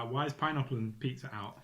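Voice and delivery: usual speaking voice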